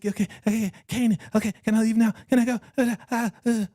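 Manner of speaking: a touch of nervous pleading; acted timid compliance. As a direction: play her as mildly scared and eager to escape. Voicing the cowed woman answering Kanan.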